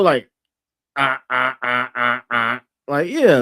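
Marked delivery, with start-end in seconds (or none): 1.0-2.6 low, robotic "ah ah" laugh; dry mock laugh. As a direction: imitate a deadpan LOL in separate beats. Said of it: "that L O L".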